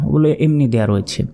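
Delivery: ordinary talk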